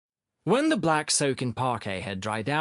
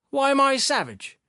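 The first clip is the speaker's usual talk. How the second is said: high, loud sullen protest; 13 semitones higher, 5 dB louder than usual. A narrator's reading. Sharikov answering an accusation, sullen. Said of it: "Sharikov responded sullenly".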